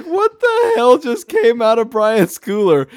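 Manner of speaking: high, loud astonished question; retold disbelief. Acts out group's shocked reaction.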